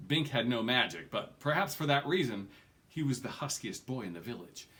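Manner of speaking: plain reading voice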